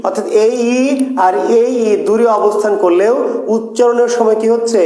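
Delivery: ordinary delivery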